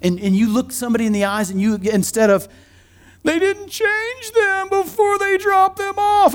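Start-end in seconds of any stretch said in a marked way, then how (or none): 3.2-6.3 high, slow, put-upon parent voice; playing a huffy parent, drawn out; mock offended parent. Parent griping about the nursery, in a high mock-offended voice.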